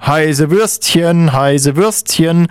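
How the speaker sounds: high, drawn-out street-vendor cry; vendor's call. Acted. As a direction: call out your wares, stretching each word. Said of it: "Im Stadion ruft der Verkäufer".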